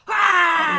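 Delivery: loud high "Ah!" of exasperation; frustrated yell. Retold or imitated. Fed up with the customers who interrupted her, shouted. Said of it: "I was just like".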